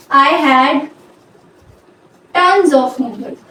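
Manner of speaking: broken by a long pause; slow dictation. Shown as a demonstration. Dictating example while writing it out.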